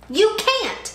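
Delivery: high loud bully shout. A narrator's reading. Sammy shouts at Kevin, bully shout. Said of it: "Sammy shouted".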